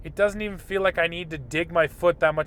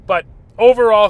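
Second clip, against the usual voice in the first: usual speaking voice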